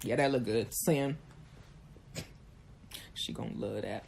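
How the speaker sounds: low, lazy dude voice, dropping to a mumble; mocking a man. Mocking men who send pictures, low smug dude voice. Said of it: "I swear it's just like".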